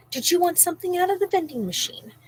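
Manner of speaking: high, sugary imitation of the stranger; mock sweet lady. Imitating the stranger offering the kids snacks, high and sugary. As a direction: put on the lady's sickly-sweet offer. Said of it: "and she's like".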